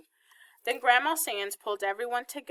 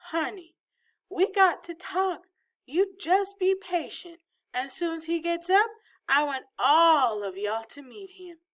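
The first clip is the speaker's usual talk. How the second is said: coaxing Southern grandmother; 7 semitones higher, 3 dB louder than usual. A narrator's reading. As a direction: stretch it out sweetly as the grandmother putting off an answer.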